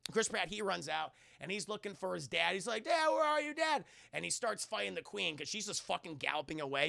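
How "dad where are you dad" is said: higher calling for Dad; searching call, higher and louder. Retold or imitated. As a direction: call across the ground for Dad.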